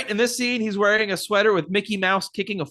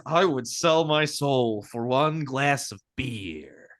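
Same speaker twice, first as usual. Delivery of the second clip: low, slow mock Jack voice; low slow imitation of Jack longing for beer; weary Jack; 7 semitones lower, slower than usual. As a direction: drag it out like a thirsty drunk. Retold Jack to Lloyd.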